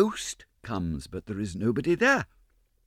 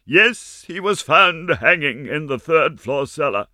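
loud, slow, high and grave; dire announcement; 6 semitones higher, 7 dB louder than usual. Acted. Ma breaks the terrible news, slow and loud. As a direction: announce the name with dread.

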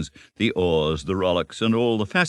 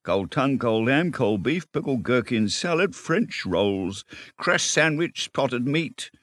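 higher-pitched running inventory; rattled-off list; 6 semitones higher than usual. A narrator's reading. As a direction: reel off the items without stopping. Rat rattles off picnic list.

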